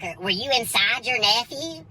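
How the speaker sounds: ordinary talk, nothing marked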